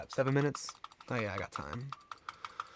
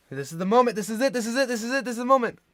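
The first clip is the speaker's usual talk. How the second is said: louder, higher building hype; hyped; 8 semitones higher, 8 dB louder, much faster than usual. Real, not acted. Hyped for the big moment.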